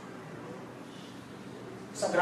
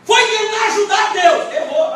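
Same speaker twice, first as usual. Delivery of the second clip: shouted rebuke, much higher and louder, slow; preaching shout; 12 semitones higher, 9 dB louder than usual. Real, not acted. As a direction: cry it out slowly at full force.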